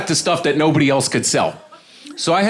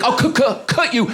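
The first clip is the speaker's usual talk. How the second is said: high-pitched stammered curse; pissed-off stutter; 9 semitones higher than usual. Retold or imitated. Heckler fed up with the roasting, angry mock stutter. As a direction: trip on the curse in mock anger. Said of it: "this guy's getting pissed".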